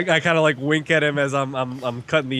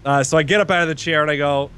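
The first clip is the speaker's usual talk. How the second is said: ordinary talk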